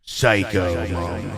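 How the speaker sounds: very slow, drawn-out Space Marine. Shown as a demonstration. Streamer replaying his birthday shout-out voice, exaggerated Space Marine. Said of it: "kind of Space Marine voice for that".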